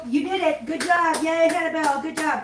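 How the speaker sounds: high, cutesy pet praise; cheering praise. Real, not acted. Praising the cat.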